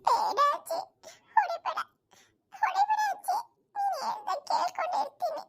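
high, whimpering plea; pleading child. Acted. Grandson begging granny not to hit him, high crying plea.

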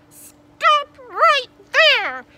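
very high, drawn-out yell; shouted order. A narrator's reading. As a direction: order it slowly at full volume. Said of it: "the voice ordered".

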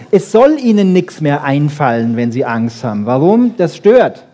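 normal talking voice

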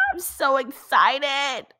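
high, slow gushing excitement; excited. Acted. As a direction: stretch it out, thrilled. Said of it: "Aisha said".

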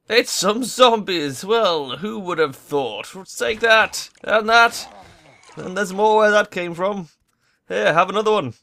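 high, loud battle taunts; excited fighting jeers; gleeful taunting. Real, not acted. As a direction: taunt the zombies as you hit them. Fighting zombies, taunting them.

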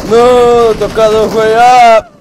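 loud panicked yelps; panicking gamer. Real, not acted. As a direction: yell in a panic as you get hit. Gets hit in the game.

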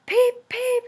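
high, loud whistle-like peep; bright high toy-engine peep. A narrator's reading. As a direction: sing it out like a little engine's whistle. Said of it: "answered Thomas".

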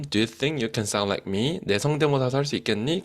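a hint of a playful animal asking; lightly voiced cartoon animal. Teacher voicing the animal's challenge, mild playful cartoon voice. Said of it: "이런 식으로 이야기를 한 겁니다".

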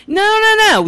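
high, loud objection; retold protest. Daughter told she is not going, loud protest.

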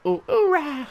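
a cute little soldier cheer; cute squeaky oorah. Pretend. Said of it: "It's all cute".

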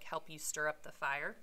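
ordinary talk, nothing marked

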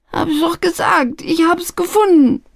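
high, wailing plea of innocence; whining insistence. Acted. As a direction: whine it as if begging. Youth insists, still whining. (hoch und weinerlich beteuernd)

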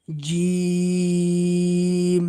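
জিম held very long on one steady pitch; three-count stretch. Shown as a demonstration. Quran teacher demonstrating the three-alif stretch of jim. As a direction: stretch জিম slowly for three beats, then stop. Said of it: "তিন আলিফ টান দিয়ে আমাদেরকে পড়তে হবে".

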